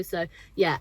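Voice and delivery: usual speaking voice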